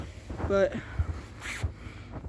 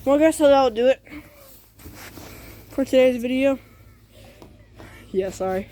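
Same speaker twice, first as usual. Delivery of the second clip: breathing a little heavy from asthma; audible breathing; 3 semitones higher than usual. Real, not acted. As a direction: talk through heavier breaths. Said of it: "that's why I'm breathing a little heavier".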